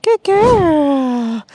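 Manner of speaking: loud, high praise for the puppy. Real, not acted. Trainer praising her puppy loudly in a high voice.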